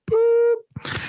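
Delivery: very high, held "boop"; imitated machine beep. Voicemail ending the message, high imitated beep. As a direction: mimic the cutoff tone. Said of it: "all of a sudden it's like".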